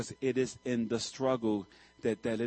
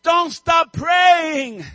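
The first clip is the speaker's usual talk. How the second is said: full-force preacher's cry, much higher and louder; preaching shout; 15 dB louder, much slower than usual. Real, not acted. Preacher urging the congregation, full-force shout. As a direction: roar it out to the whole church.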